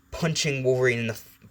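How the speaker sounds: ordinary delivery, nothing marked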